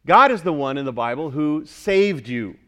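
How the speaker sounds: ordinary talk, nothing marked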